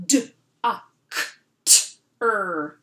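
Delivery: D, A, K, T, R sounded out one by one, loud; slow sound-by-sound. Shown as a demonstration. Teacher sounding out doctor: separated sounds. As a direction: stretch each sound apart. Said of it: "let's do this one together like this".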